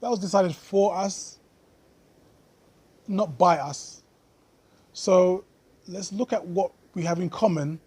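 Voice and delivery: ordinary delivery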